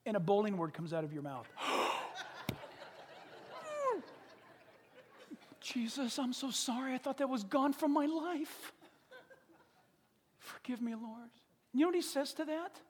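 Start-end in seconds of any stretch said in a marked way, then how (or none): 1.5-4.1 long, exaggerated horrified gasp; shocked gasp. Preacher acting shock at a slipped curse word, big gasp. 5.6-11.3 acted remorse. Preacher as stumbling believer begging forgiveness, mock contrite. Acted apology to Jesus in prayer.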